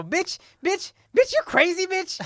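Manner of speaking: squealing high-pitched yell; high yelling insult. Retold or imitated. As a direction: shriek it out high. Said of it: "I go".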